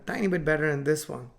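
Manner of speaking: usual speaking voice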